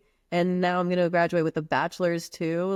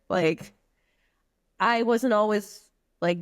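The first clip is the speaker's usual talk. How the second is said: normal talking voice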